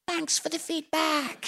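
put-on voice of hurt politeness; feigned gratitude. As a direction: squeeze out a polite thanks. Mock gratitude after harsh criticism.

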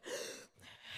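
a quick unvoiced catch of breath, like between sobs; imitated sob gasp. Retelling how she gasped mid-sob, mock sob gasp. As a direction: show the gasp she took between each word. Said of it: "between each word I was going".

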